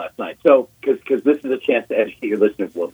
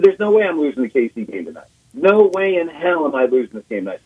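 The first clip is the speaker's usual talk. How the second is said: confident boast; 7 semitones higher, faster than usual. Retold or imitated. Retells boast before the game.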